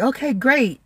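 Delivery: falsely upbeat; mock enthusiasm. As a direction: put on a chirpy acceptance. Sarcastic relief on seeing all the food.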